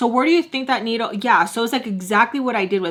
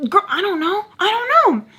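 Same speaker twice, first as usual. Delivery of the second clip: high-pitched, flustered insistence; flustered retort; 12 semitones higher, 3 dB louder than usual. Retold or imitated. Storyteller replaying her answer to her friend, high and flustered. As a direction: throw it out high, rising louder on the repeat.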